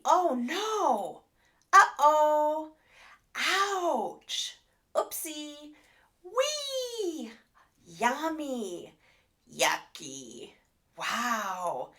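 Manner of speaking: playful, high, expressive little exclamations; exclamatory words. Shown as a demonstration. Therapist showing exclamatory words with lots of expression. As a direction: say each one full of emotion for a toddler. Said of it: "examples of exclamatory words might be".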